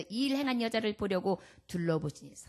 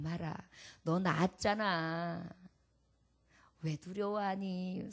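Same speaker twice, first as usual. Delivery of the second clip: tender comfort; 4 semitones lower than usual. Acted. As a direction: hush her fears gently. Jesus comforts the frightened woman.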